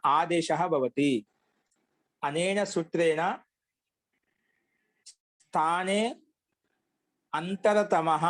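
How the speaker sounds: measured Sanskrit dictation with long pauses; slow dictation. Acted. Teacher dictating a grammar rule to students, slow dictation. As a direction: dictate it phrase by phrase, drawing out the syllables.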